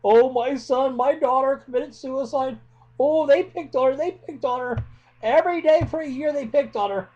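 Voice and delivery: high, hammed-up wail of a parent on the news; mocking grieving parent. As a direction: mimic the parent crying to reporters, overdone. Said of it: "we see y'all after the fact".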